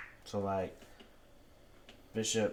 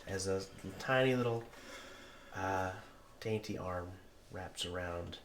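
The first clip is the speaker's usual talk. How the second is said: usual speaking voice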